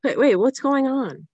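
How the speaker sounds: demanding answers over the crowd; alarmed shout. Acted. Riot noise on the phone.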